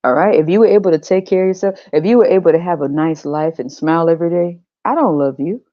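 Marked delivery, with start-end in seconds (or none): none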